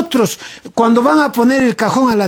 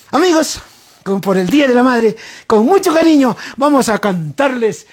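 show announcer; 3 semitones higher than usual. Acted. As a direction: hype up the band's entry. Introducing the song like an announcer.

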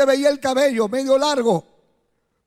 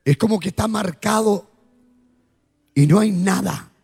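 altar call; 5 semitones lower than usual. Acted. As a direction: invite them gently, eyes closed. Altar call over music.

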